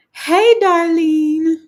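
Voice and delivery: loud, high, drawn-out squeal; excited greeting. Real, not acted. Host spots her friend joining the chat, excited greeting. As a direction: sing out the name high and slow.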